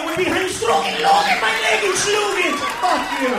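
loud, high, furious comic tirade; ranting comeback. Acted. American tourist telling off French waiter, angry shouting. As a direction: build to a yelled insult.

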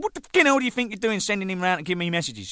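high, quick indignant outburst; fast, exasperated telling-off; retold angry demand. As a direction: tear into him, acting out the row. Retold row with bandmate.

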